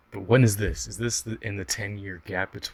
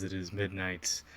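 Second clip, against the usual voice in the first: sexy narrator voice; 2 semitones lower, 7 dB quieter than usual. Pretend. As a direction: purr it like a late-night narrator. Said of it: "I'm gonna be adopting the sexy narrator voice".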